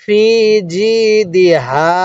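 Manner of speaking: ফি and জি drawn out, then দি হা; measured ফি জি দি হা with pauses between; slow model reading. Shown as a demonstration. Teacher showing how to read, stretched ফি and জি. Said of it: "কিভাবে শিখব".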